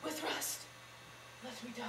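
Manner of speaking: weakening, fading stage death; faint last breath; dying words. Acted. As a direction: let the voice sink away as you die. Juliet dying.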